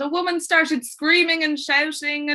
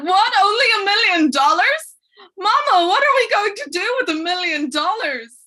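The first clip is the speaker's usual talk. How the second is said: loud, high outraged cry of spoilt sons; indignant protest; 8 semitones higher, 7 dB louder than usual. Acted. Three pampered pig boys protest to Mama, indignant and loud. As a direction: protest loudly, pitch swooping up in disbelief.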